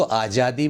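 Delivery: 'आज़ादी' with j instead of z; common mispronunciation. Shown as a demonstration. Teacher quotes what people say, mispronounced. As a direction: say a j sound in 'आज़ादी'. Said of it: "यह गलत".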